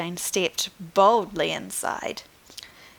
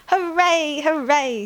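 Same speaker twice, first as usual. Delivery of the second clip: put-on high, loud child's cheer; 7 semitones higher, 4 dB louder than usual. Little girl cheering about the dinosaur store, high and loud. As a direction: cheer it out with joy.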